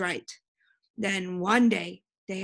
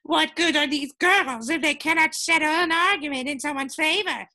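higher, indignant outburst; angry growl; 7 semitones higher, 4 dB louder than usual. A narrator's reading. Brothers furious at the princesses' verdict, growling indignantly. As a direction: complain angrily at a raised pitch. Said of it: "they growled".

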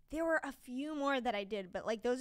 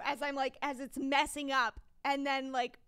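ordinary talk, nothing marked

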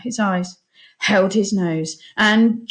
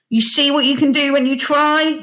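raised, hearty captain's voice; captain's praise; 7 semitones higher, 5 dB louder than usual. A narrator's reading. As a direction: praise the boy, higher and louder. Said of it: "he said".

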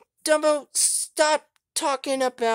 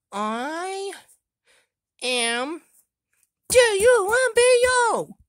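put-on higher, louder babyish spelling; lightly voiced babyish toy; childish voice; 10 semitones higher, 7 dB louder than usual. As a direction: spell it like a little kid. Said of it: "a very just childish accent".

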